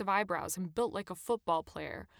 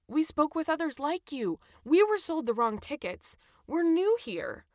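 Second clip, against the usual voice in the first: high, earnest plea; pleading; 8 semitones higher, 5 dB louder than usual. A narrator's reading. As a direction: plead your case in a raised pitch. Narrator voices her plea to checker.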